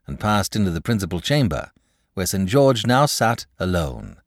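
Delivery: plain reading voice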